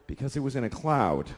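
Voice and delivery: weary dad. Retold or imitated. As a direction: answer like an exhausted father. Tired dad answering.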